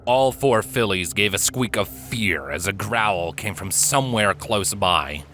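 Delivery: usual speaking voice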